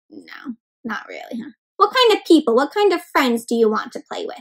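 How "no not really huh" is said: barely voiced, whispered reply; much softer and lower whisper; hushed answer. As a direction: answer softly, almost under your breath. Softly answering own question.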